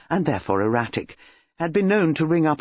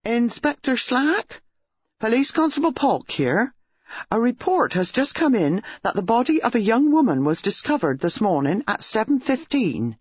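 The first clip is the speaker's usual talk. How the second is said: put-on a stiff young constable reading his report; police constable; 8 semitones higher than usual. A narrator's reading. Constable phoning the inspector, high formal voice.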